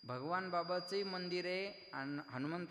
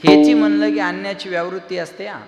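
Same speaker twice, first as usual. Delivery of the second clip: loud, ringing kirtan sermon voice; a kirtankar thundering at the gathering; full-force preaching; 19 dB louder than usual. Acted. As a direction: preach it out to the whole crowd.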